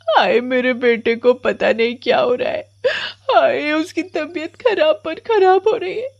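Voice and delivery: high-pitched tearful mother's lament; distraught crying. Acted. Mother laments her son's worsening illness, crying. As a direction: wail the worry through sobs. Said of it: "बहुत परेशान हो जाती है और बहुत जोर से रोने लग जाती है".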